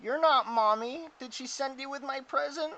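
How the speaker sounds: put-on high, plaintive child creature voice; tearful young orc. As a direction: ask it like a little one close to tears. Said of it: "with tears in their eyes".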